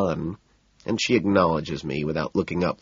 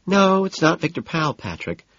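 casual; 10 semitones higher than usual. A narrator's reading. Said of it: "Evelyn says casually".

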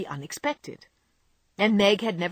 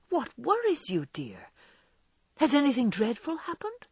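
anxious tenderness; 6 semitones higher than usual. A narrator's reading. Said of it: "asked the anxious John".

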